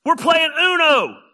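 ordinary talk, nothing marked

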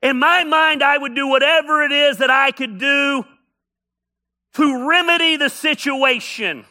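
loud, high, driving preaching cadence; preaching at full force. Acted. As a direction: hammer each enough is enough from the pulpit. Sermon climax, enough is enough.

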